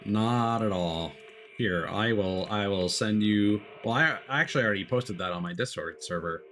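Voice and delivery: normal talking voice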